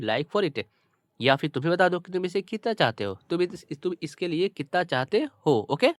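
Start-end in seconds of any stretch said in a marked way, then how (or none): none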